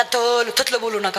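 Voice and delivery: lisped "तल, थतलं" with t for s and r; lisping student. Retold or imitated. Stammering student answers his law teacher with a lisp. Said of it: "ती बोबड आहे".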